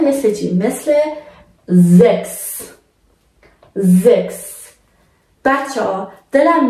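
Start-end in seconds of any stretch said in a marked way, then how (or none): 1.7-4.7 زکس with a crisp final "ks"; German زکس said slowly as "zeks"; chs as x. Shown as a demonstration. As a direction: end زکس on a clear x sound.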